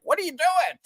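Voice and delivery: high-pitched imitation of shocked onlookers; hammed-up shocked question; mock shock. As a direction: squeal it in pretend disbelief. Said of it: "a shock. They weren't like".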